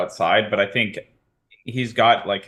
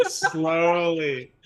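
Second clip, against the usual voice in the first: SLOWLY stretched out, raised in pitch; drawn-out slowly; 6 semitones higher than usual. Acted. Player narrating Gut getting up, drawn-out slowly. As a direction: drag out the word slowly. Narrating a character's laboured slow movement.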